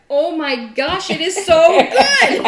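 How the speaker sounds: high, loud, slow cry of delight with a laugh; thrilled delight. Real, not acted. Cook tasting her turkey after hours of worry, thrilled and laughing. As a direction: let joy burst out at the first bite.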